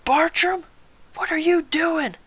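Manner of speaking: high, loud drill-sergeant bellow; yelling sergeant. Retold or imitated. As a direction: imitate a sergeant yelling across the hall. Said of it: "Gunnery Sergeant yelling".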